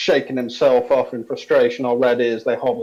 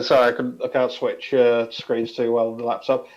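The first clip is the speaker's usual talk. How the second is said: normal talking voice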